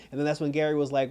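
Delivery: usual speaking voice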